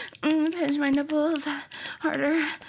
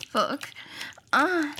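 ordinary talk

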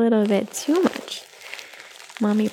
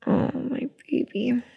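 whisper; 4 dB quieter than usual. Acted. Whispering to baby.

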